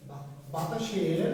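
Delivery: slow, drawn-out dictation. Shown as a demonstration. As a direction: stretch it out for students writing. Teacher dictating slowly for notes. (ধীরে ধীরে বলে লেখানো)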